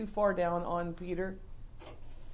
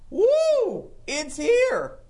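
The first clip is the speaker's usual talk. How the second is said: high, loud whoop of joy; excited whoop; 11 semitones higher, 9 dB louder, much slower than usual. Pretend. Disciple cheering that the Messiah has come, excited whoop.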